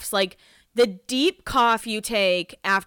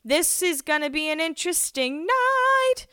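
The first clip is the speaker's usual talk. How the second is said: high lilting sing-song; imitating a sing-song exit line; 8 semitones higher than usual. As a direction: sing it out teasingly as she walks away. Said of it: "she does her sing-song voice".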